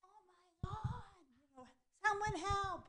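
high-pitched mock scream for help. Re-enacting screaming for help at the intruder, high mock scream. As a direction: squeal it out, hammed-up panic. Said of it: "So I start screaming".

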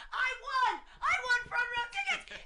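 high re-enacted victory squeal; excited screaming. Winner led down the arena stairs, screaming. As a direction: scream your win for everyone to hear. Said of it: "and I'm screaming".

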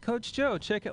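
put-on high, eager boastful kid voice; a proud little kid showing off; lightly voiced proud kid; kid's voice. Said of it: "Real, like, just like".

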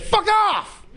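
a touch of a rude recruiter; gruff military brush-off. Retold or imitated. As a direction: snap it like a dismissive officer. Military rejects the cats.

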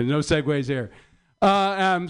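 normal talking voice

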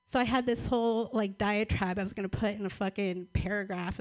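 normal talking voice

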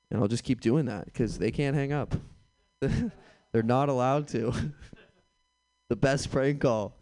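ordinary talk, nothing marked

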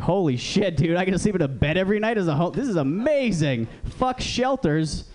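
high, excited acted discovery; amazed delight. Homeless newcomer discovers free street beds, amazed delight. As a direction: marvel at it, stretching the last words.

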